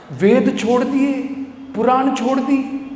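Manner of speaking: high, protesting objector; incredulous challenge. Acted. Objector questions devotee worshipping Nanda, high incredulous protest.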